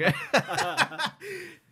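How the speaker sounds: loud high burst of laughter. Real, not acted. Cracking up at the guest's sly look, high laugh.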